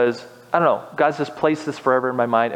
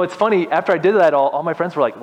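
ordinary talk

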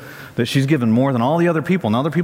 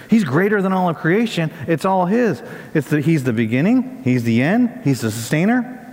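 rising preaching; 6 semitones higher than usual. Acted. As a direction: proclaim it with full pulpit force. Preacher builds to full force.

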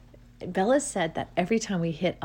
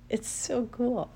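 moved to tears; 5 semitones higher, slower than usual. Real, not acted. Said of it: "Like, I wanna cry right now".

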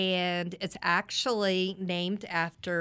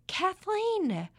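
concerned mom; 11 semitones higher, 4 dB louder, slower than usual. Retold or imitated. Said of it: "she is like".

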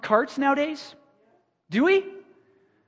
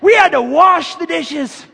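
higher and louder, hammed-up grumpy elder lecturing kids; mock old-timer; 4 semitones higher, 6 dB louder than usual. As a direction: play a fussy old parent bragging about hardship. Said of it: "And you're gonna be like".

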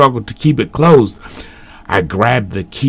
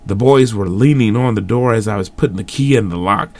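ordinary delivery, nothing marked

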